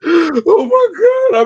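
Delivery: breathless laughing exclamation; cracking up out of control; gasping through laughter; laughing hard. Real, not acted. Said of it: "I'm in tears".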